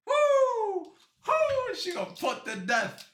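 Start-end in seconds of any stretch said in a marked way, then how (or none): 0.1-1.7 very high, very loud whoops; stadium-style whooping; whooping cheer. Real, not acted. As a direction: let out a huge victory whoop. Cheering the verdict.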